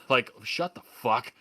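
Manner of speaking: normal talking voice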